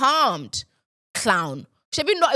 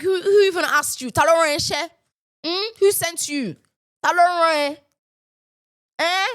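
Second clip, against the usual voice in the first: higher, louder, taunting; mocking dismissal; 5 semitones higher, 3 dB louder than usual. Real, not acted. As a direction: switch to Yoruba to taunt. Dismissing commenter in Yoruba.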